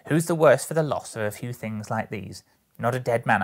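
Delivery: loud charwoman justifying herself; brazen woman, defiant. Acted. Woman excuses stealing from a dead man, defiant. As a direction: argue it loudly and dismissively.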